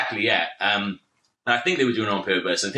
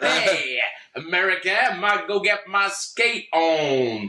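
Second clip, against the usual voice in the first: hammed-up Los Angeles accent, pitched higher; Venice Beach accent; 6 semitones higher, much slower than usual. Welshman fitting in at Venice Beach, mock LA accent. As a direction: put on a slow, drawn-out Venice Beach accent. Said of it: "fit in with a Venice Beach lot".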